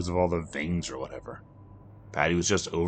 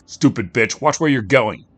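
louder, higher angry curse; angry snap; 5 semitones higher, 10 dB louder than usual. Acted. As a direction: spit the insult at his wife. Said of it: "he said at her".

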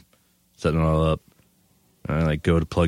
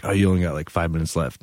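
usual speaking voice

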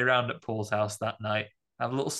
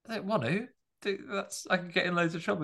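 mock whiny protest; 7 semitones higher, faster than usual. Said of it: "He goes".

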